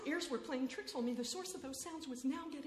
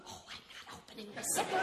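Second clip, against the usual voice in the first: scared whisper; faster than usual. Acted.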